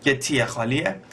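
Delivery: normal talking voice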